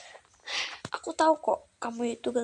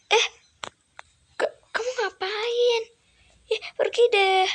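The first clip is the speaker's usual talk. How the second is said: scared shoo; 10 semitones higher, 6 dB louder, slower than usual. Acted. Shooing away the intruder.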